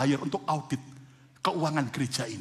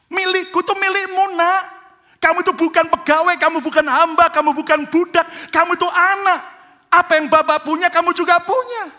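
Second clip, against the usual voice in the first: loud, high, driving sermon delivery; full-force preaching; 13 semitones higher, 11 dB louder than usual. Acted. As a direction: preach it with rising fire to the congregation. Preaching the father's words.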